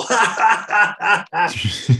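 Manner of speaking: big, high, loud guffaw; imitated booming string of ha-has; Michael Caine. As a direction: let out a hammed-up belly laugh. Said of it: "And then big Kane guffaw".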